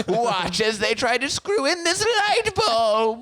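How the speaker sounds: high, slow gloating voice; mock the creations gleefully; villain voice. Continuing the villain bit.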